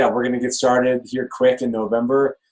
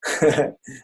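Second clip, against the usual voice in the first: laugh; 5 semitones higher than usual. Real, not acted. Laughs after mock voiceover.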